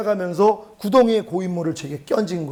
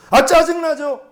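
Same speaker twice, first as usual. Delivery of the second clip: irritated answer; 7 semitones higher, 5 dB louder, slower than usual. Retold or imitated. His irritated answer to God.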